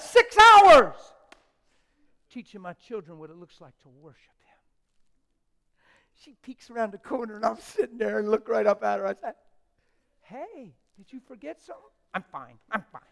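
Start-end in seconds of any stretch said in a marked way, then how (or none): 0.0-1.0 much higher, louder shout of "Six hours!"; shouted repeat. Acted. Preacher repeats with emphasis. 6.2-13.0 loud, high preacher's fervour; preaching at full force. Acted.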